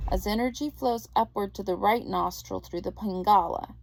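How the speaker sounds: ordinary talk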